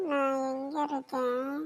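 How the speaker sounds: slow, soft, groggy question; half-conscious grandmother, slow and soft; dazed waking. Acted. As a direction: come round from the faint, slow and soft.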